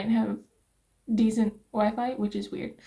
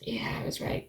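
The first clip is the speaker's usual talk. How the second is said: whispering; 8 dB quieter than usual. Real, not acted. Reading bad result.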